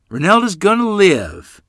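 high, loud, grinning delivery; joyful announcement. A narrator's reading. Mother brings news of sick girl, joyfully. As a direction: proclaim the good news happily. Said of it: "grinning from ear to ear".

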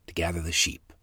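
usual speaking voice

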